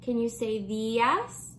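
normal talking voice

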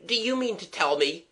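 mild, high-pitched outcry; near scream. Acted. Bewildered victim questioning Gerald, mildly frantic. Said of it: "almost screamed".

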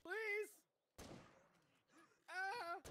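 high, loud urgent begging; panicked plea. Real, not acted. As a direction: plead for help in panic. Begging for help in game.

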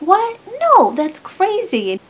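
a high, loud "what?" then a loud brush-off; flustered denial. Retold or imitated. The friend asked about secretly dating, loud flustered denial.

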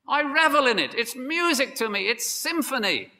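very high-pitched, ecstatic preaching; rapturous delight. Acted. Preacher acting out Paul revelling in God's rules, rapturous. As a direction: revel in every phrase, voice soaring. Paraphrases Paul's joy in the law.

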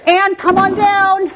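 drawn-out game-show call; slow, lifted announcer call, inviting her in. Real, not acted. Host welcoming a model onto the set, slow announcer call.

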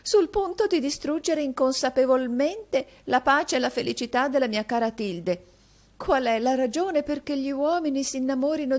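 high, loud theatrical lament; self-dramatizing lady in a lifted voice; melodramatic vain mistress. A narrator's reading. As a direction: bewail your irresistible charms.

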